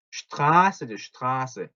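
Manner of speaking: "슈트라스" opening with sht, as in German Straße; correct sh sound. Shown as a demonstration. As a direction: start "슈트라스" on a clear sh. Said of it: "예를 들면 요거".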